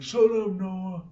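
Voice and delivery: high, imitated angry retort; irritated. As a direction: fire it back as a mock brush-off. Rival snaps back in retold quarrel.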